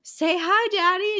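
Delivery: high, sing-song coaxing; sweet mother voice. Retold or imitated. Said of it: "Lavinia's like".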